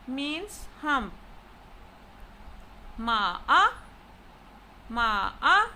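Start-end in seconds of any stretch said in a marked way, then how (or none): 3.0-5.7 "Ma-a" dipping low, then jumping up louder; third tone on Ma-a. Shown as a demonstration. Teacher showing the word for horse, "Ma-a" in dipping tone. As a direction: sink on "Ma", swing up hard on "a". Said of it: "look at the tonal variations of ma".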